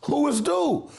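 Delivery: normal talking voice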